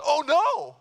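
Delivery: high, loud shout of dismay; high-pitched yell of bad news. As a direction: cry it out in sudden alarm. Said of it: "I just shouted out loud".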